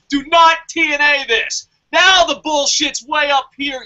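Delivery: high, loud scolding shout; furious warning shout; angry shout. Real, not acted. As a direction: yell the order at them. Scolding New Japan.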